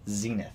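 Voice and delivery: Zenith said ZEE-nith, quietly; first syllable of Zenith rhymes with "see"; long E in Zenith. Shown as a demonstration. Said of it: "Matt is correct. It is".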